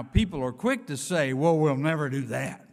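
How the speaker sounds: ordinary delivery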